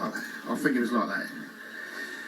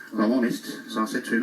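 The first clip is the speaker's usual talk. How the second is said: ordinary talk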